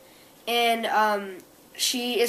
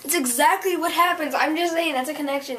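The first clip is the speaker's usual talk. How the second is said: higher-pitched, eager insistence; excited recognition; 6 semitones higher, 3 dB louder than usual. Real, not acted. Teller sees own life in the story, excited. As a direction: gush about the connection.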